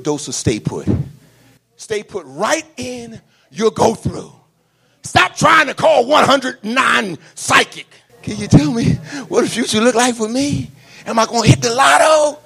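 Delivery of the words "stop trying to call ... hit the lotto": mocking caller.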